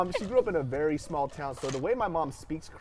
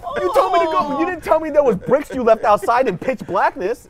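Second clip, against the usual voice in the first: louder, higher indignant protest; retold protest; 11 semitones higher, 12 dB louder than usual. To his dad about the bricks, louder higher protest. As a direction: protest it loudly. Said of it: "I was like".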